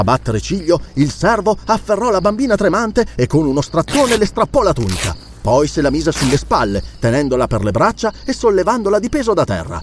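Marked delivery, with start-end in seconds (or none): none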